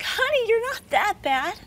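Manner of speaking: high, breezy reassurance; coaxing a scared husband into the car, airy and high; brushing off worry. Retold or imitated. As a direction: wave away his fear lightly. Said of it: "And I'm like".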